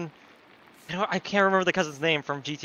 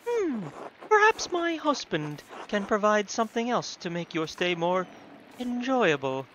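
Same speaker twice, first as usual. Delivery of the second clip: higher, sensual hostess voice; 5 semitones higher than usual. Acted. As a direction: purr it suggestively, pitched up. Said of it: "I read that so sensually".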